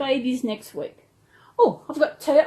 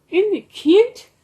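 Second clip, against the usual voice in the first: cooing; 7 semitones higher, 7 dB louder, slower than usual. Pretend. Showing toy rabbit.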